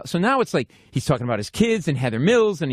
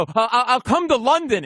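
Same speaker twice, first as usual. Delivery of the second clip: high, halting blurt; stammering; 8 semitones higher than usual. Retold or imitated. Fan blurts offer to star, high mimicked stammer. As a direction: stumble into it at a raised pitch. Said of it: "like, stammering".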